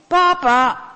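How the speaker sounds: high, distant call; voice from upstairs. Acted. Unseen person calling from upstairs, high and distant. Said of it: "klinkt het dan ineens van boven".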